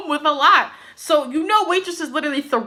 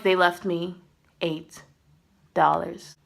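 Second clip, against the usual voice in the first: softer, halting, with pauses between words; deadpan quiet; 6 semitones lower, 5 dB quieter, slower than usual. Retold or imitated. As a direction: let each word drop flat and soft. Retelling own stunned reply to Joe.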